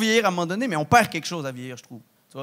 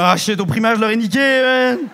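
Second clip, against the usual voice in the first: louder, higher mock regret; late comeback yell; 5 semitones higher, 8 dB louder than usual. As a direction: bark the missed comeback. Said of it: "t'es comme". (cri de répartie tardive, plus fort)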